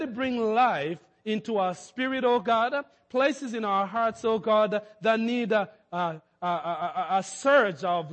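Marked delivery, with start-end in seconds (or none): none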